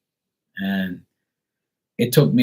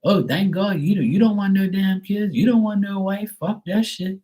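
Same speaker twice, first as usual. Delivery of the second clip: high, gushing mock voice; high mock voice of friends glad he's single; mocking relieved people; 11 semitones higher than usual. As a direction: parody the people who now envy him. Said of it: "they say".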